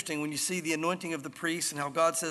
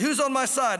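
louder, higher call to a window; 6 semitones higher, 5 dB louder than usual. Acted. Jehu to servants in the palace windows, calling up. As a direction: call out for allies overhead.

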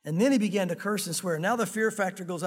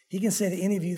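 ordinary talk, nothing marked

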